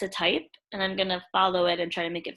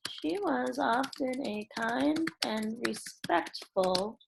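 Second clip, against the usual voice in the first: dictating while typing; much slower than usual. Acted. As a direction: read each word out slowly as you type it.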